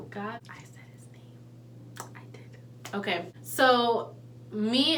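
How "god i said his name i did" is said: hushed whisper, almost no voice. Real, not acted. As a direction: breathe it out in a panicked whisper. Retelling a tense moment, self-reproach.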